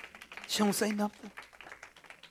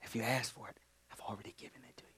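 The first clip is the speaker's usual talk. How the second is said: very soft whisper; 5 semitones lower, 11 dB quieter, much faster than usual. Real, not acted. Preacher whispering God's promise, very soft. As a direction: let it fall to a whisper.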